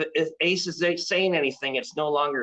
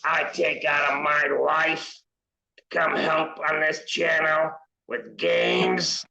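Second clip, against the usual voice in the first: put-on possessed old woman; slower than usual. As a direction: rant as a fed-up possessed lady. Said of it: "he channels her".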